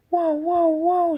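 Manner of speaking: high, loud spinning whoas; shouting whoa. Acted. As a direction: shout as you spin out of control. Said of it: "she shouted".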